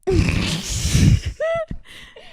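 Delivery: loud, high cackling laugh; real high-pitched laughing fit; burst of laughter. Real, not acted. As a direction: crack up helplessly at drawing a blank. Blanks on answer, bursts out laughing.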